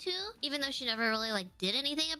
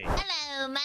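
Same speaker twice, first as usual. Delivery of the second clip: mock British accent; 3 semitones higher, 3 dB louder than usual. Guest teasing the British host, mock British accent. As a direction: greet him in a playful British accent. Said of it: "the stereotypical British accent".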